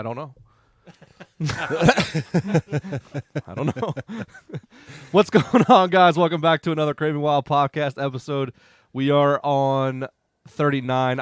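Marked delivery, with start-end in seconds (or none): 5.1-11.2 loud, upbeat host welcome; projected opener to the listeners; podcast intro. Real, not acted. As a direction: launch the show with a big raised voice. Host does the show intro.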